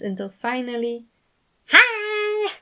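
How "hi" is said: high, loud burst of a greeting; loud cheery hi. A narrator's reading. Remus bursting into the room, high loud greeting. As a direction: pop in and startle everyone. Remus's sudden startling appearance.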